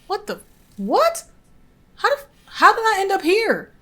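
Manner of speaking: can't believe where he landed; high shocked what; shocked disbelief. Real, not acted. Teleported to the wrong place.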